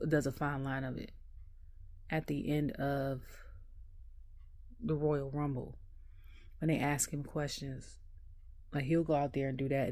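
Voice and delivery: ordinary talk, nothing marked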